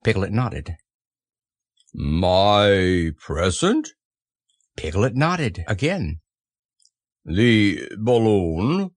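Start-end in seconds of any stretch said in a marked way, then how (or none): 1.9-3.9 very slow, low, rising question; low donkey drone; doleful Eeyore. Acted. Eeyore questions the ruined present. 7.2-8.9 very slow, drawn-out question; slow donkey drone, rising at the end; dismayed Eeyore. Acted. Eeyore questions the ruined present.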